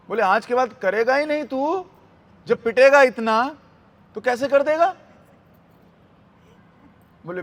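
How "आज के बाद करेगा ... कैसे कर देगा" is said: high, loud threatening questions; डांटती गोपी. Retold or imitated. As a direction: scold a naughty child, voice rising into each challenge. (ऊँची आवाज़ में धमकाती डांट)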